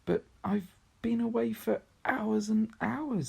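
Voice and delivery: put-on high and slow, earnest girl; insisting girl. Lucy insisting to the others, high and slow. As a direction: stretch it out, pleading to be believed.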